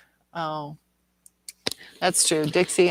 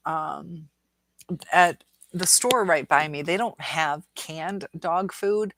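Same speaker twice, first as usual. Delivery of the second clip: normal talking voice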